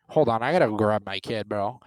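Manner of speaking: ordinary talk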